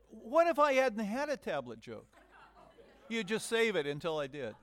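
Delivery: loud laughing protest. Real, not acted. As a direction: laugh while teasing back. Laughing at the planted joke.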